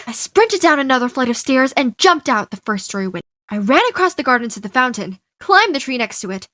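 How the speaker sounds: ordinary delivery, nothing marked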